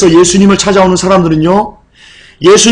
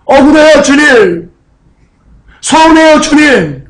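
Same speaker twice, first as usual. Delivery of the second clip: high, aggrieved acted plea; pouring out hurt; 4 semitones higher than usual. Martha reproaching the Lord over her dead brother, hurt and pleading. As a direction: protest to the Lord, pitch climbing on each complaint. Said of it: "서운함을 토해내는 겁니다".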